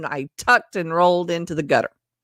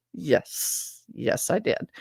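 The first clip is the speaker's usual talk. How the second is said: whispered "yes, yes, I did"; sheepish quiet yes; 3 semitones lower, 10 dB quieter, much slower than usual. Retold or imitated. Answering coworker sheepishly, whispered. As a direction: admit it in a hushed whisper. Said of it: "I'm like".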